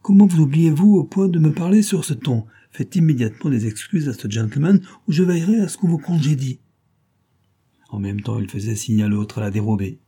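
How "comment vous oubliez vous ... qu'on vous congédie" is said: higher, louder scolding of the servant; a master berating his servant in anger; angry rebuke. A narrator's reading. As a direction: tear into the insolent servant. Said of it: "apostrophant violemment le domestique".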